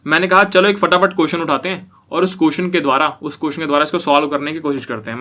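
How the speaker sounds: ordinary delivery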